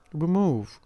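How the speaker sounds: "remove" with a loud stressed MOVE; light "re", then punch "move" in "remove"; stress on the stem. Shown as a demonstration. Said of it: "the stress is kept on the original word".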